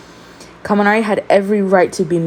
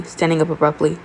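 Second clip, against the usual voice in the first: plain reading voice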